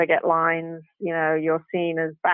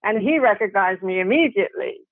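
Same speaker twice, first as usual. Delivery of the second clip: usual speaking voice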